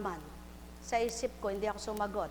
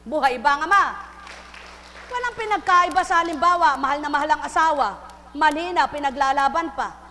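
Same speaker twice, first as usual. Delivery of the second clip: loud, raised cry to the congregation; preaching at full force; 3 semitones higher, 9 dB louder than usual. Acted. Preacher crying out to the flock, loud and higher. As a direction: belt it out from the pulpit.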